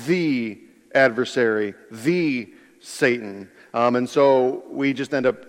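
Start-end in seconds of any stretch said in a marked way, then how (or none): 0.1-3.5 "The" drawn out as thee before each title; weighty long "the", then a short pause; stress on THE. Shown as a demonstration. Preacher explaining the devil's title, "the" stressed and stretched. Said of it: "capital T".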